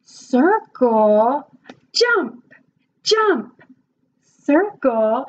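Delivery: excited teacher prompt. Shown as a demonstration. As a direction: cheer the child to jump. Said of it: "So jump up and down".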